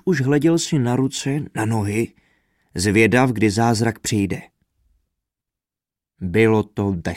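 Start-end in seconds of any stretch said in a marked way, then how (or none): none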